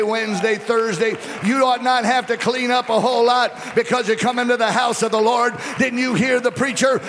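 loud, high-pitched pulpit proclamation; full-force preaching. Acted. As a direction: drive it home to the congregation at full voice.

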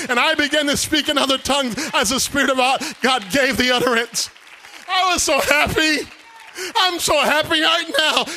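loud, ringing revival preaching; loud joyful preacher; preaching at full force. Acted. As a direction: preach it out, building joy.

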